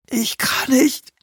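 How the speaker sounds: slow, high wail; whining. A narrator's reading. Rubin lies trapped under the shelf, wailing. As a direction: whimper helplessly. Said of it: "jammerte Rubin".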